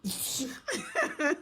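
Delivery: soft, held-back giggle; toned-down phone laugh. Shown as a demonstration. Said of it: "That's more like my phone laugh".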